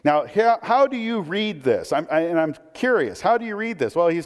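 ordinary talk

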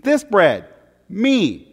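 ordinary talk

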